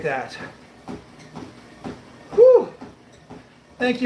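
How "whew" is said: loud whew. Real, not acted. Exhale after the treadmill stretch.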